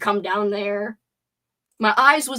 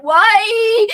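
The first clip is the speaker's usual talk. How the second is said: mock-tragic wail, much higher and louder; anguished cry; 11 semitones higher, 11 dB louder than usual. Kicked by the director, crying out from the ground in mock anguish. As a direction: wail it like a wounded hero. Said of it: "I fell to the ground and cried".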